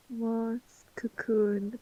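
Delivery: very soft, faint echo; dazed murmur. Pretend. Player repeats the vision before passing out, dazed murmur. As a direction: murmur it as if drifting out of consciousness.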